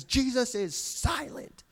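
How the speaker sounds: slow, high mock of a pastor's emphatic line; emphatic preacher. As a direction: lean on it like a showman preacher. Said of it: "he's, like, emphatic".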